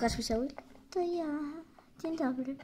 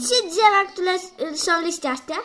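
louder, higher quiz question; 4 semitones higher, 7 dB louder than usual. Pretend. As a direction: fire the question at the class.

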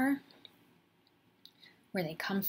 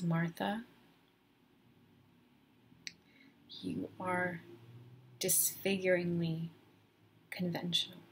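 measured, with long pauses between words; deliberate verdict. Retold or imitated. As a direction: deliver the judgment word by word, letting each hang. Boyfriend's slow verdict on her.

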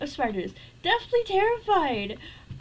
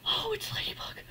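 whisper; 3 semitones higher, 8 dB quieter, faster than usual. Real, not acted. Relief hushed at in-game creature.

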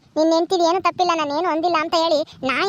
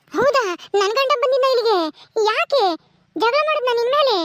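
feigned surprise; 3 semitones higher than usual. Acted. Neighbour hears her husband fought.

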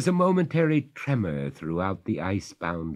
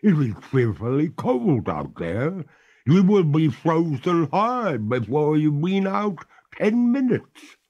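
ghost lady, high and louder, pleading; 10 semitones higher, 7 dB louder than usual. Acted. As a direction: beg him not to go, high voiced. Ghost pleads against cold.

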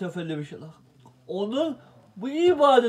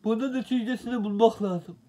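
usual speaking voice

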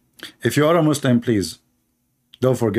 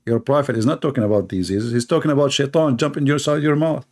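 ordinary talk, nothing marked

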